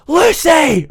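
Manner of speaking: very high, loud cry of the name; yelling after her through the window; shocked yell. Retold or imitated. Teacher yells through window.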